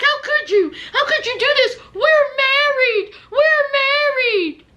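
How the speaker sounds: high, hammed-up sobbing wail; mock crying Courtney. Courtney wailing at her husband, hammed-up mock sobbing. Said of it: "And so she's like".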